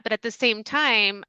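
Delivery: ordinary talk, nothing marked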